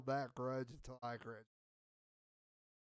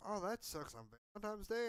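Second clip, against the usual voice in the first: lightly voiced girl, raised pitch; retold girl's sympathy; 6 semitones higher, 4 dB quieter than usual. Girl hears of the breakup, mock sympathy. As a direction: lift the pitch to imitate her reaction.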